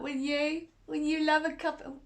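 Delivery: usual speaking voice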